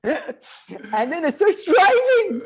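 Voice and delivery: laughing through the words, slow and high; cracking up mid-story; breaking into real laughter. Real, not acted. Laughing while telling a story.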